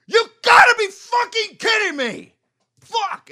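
very high, furious full-throated yell; top-of-lungs scream. Retold or imitated. Fed-up shopper snapping at the slow lottery customer, screaming. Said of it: "Top of my lungs screamed it".

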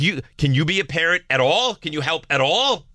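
high-pitched mock nagging yell; retold yelling. Wife demanding parenting help from husband, mock yell. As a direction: imitate a fed-up spouse raising the voice. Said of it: "And then she yells at me".

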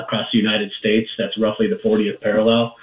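ordinary talk

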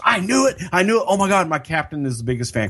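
high, fast starstruck cop; mock excited cop; excited fan. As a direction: gush like a thrilled fan.

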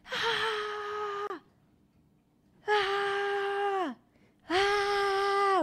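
high mock screams, rising to a cry. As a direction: scream like terrified passengers. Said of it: "todos empiezan a gritar". (gritos agudos de pánico)

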